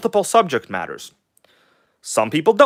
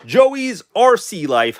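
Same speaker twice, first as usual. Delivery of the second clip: higher, slow, stretched-out showman voice; playful hype voice, dragged out and higher; fun put-on voice; 8 semitones higher, much slower than usual. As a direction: drag the name out like a goofy host. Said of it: "why do I keep talking like this?".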